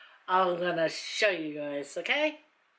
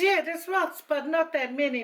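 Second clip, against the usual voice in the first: quoting husband; 8 semitones higher than usual. Retold or imitated. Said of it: "And he goes".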